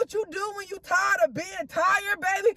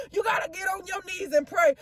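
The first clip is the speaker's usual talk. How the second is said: usual speaking voice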